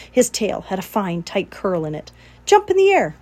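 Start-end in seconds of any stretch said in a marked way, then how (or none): 2.4-3.1 high, loud cry. A narrator's reading. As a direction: shout the next trick. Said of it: "cried Charlotte".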